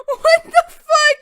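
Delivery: very high, loud, slow scream; shocked scream. Real, not acted. Streamer screams at what the game shows, horrified. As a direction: drag out a horrified shriek.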